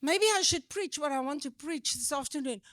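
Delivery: ordinary talk, nothing marked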